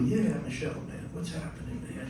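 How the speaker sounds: underplay it, casual and soft; playing it cool. Keyboardist greets his idol, acting cool and understated. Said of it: "And inside, I'm very cool".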